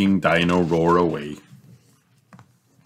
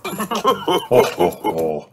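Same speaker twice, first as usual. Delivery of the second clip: chuckles; 5 semitones higher, much slower than usual. A narrator's reading. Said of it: "chuckles Daddy Pig".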